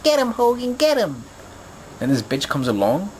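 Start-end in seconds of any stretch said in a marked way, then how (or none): 0.0-1.2 put-on high excited child's cheer; kid cheering. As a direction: yell it high like a boy at his first match. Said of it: "a little kid".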